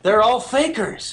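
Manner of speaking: high, loud, drawn-out exclamation; amazed revelation. Retold or imitated. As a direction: cry it out like a sudden discovery. Retold sudden realization about announcers.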